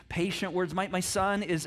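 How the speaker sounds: usual speaking voice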